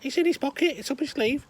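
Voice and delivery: very high, loud squawking parrot. Acted. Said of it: "parrot's there going".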